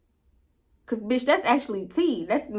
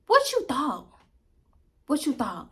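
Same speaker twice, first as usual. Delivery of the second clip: mocking; 5 semitones higher than usual. Real, not acted. Mocking rhetorical question.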